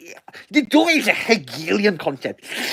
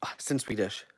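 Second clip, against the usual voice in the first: whisper; 9 semitones lower, 15 dB quieter than usual. Real, not acted. Announcement comes in Swedish.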